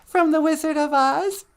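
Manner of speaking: high, coaxing question; sing-song to a child. Acted. Host cooing to child about the movie. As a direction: ask it like talking to a toddler.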